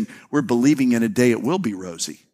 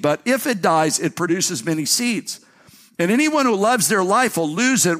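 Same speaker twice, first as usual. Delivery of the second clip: higher, declaiming reading of Jesus' words; scripture reading; 5 semitones higher than usual. Acted. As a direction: proclaim the words of Jesus to the church. Reads scripture aloud.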